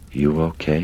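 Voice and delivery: usual speaking voice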